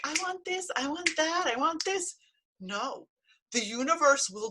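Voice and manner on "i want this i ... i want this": put-on higher, whiny "I want" chant like a grabby child; mock greedy wishing. Mocking a wisher who demands things, higher and whiny. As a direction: mimic someone demanding things from the universe. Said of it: "You're like".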